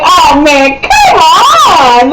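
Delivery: high, loud groan of frustration; frustrated outcry. Retold or imitated. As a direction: cry out like the book just cut you off. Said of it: "I was like".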